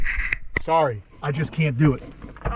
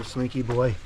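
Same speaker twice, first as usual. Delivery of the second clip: soft cooing; 4 semitones lower, 5 dB quieter than usual. Real, not acted. Cooing to pet.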